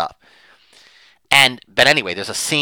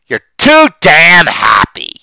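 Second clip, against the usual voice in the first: acted husband's outburst; 7 semitones higher, 10 dB louder than usual. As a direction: hurl it at her, hammering the last words. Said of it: "he's gonna tell them what her problem is".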